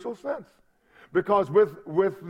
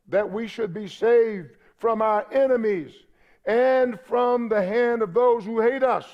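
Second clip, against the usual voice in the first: ordinary talk, nothing marked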